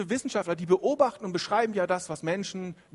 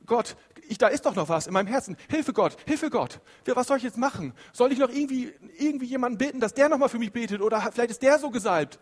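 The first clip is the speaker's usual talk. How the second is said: anxious pleading; 5 semitones higher, 4 dB louder than usual. Acted. Voicing an anxious believer praying.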